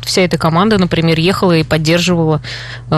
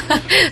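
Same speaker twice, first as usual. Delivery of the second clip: short laugh; 10 semitones higher than usual. Real, not acted. Laughs at being named a fan.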